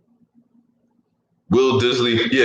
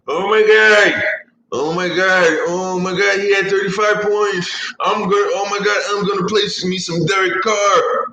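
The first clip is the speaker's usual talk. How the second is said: higher, excited Kevin Hart squeal; Kevin Hart impression; 7 semitones higher than usual. Fantasy newbie hyping Derek Carr, Kevin Hart voice. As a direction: gush like Kevin Hart over the stats. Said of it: "I did my little Kevin Hart impersonation".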